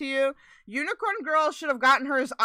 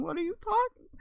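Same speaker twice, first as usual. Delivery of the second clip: ordinary delivery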